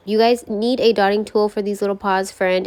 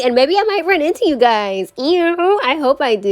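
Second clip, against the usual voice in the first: higher, excited lilt; giddy hope; 6 semitones higher, 4 dB louder than usual. Real, not acted. Imagining meeting her viewers, high giddy hope.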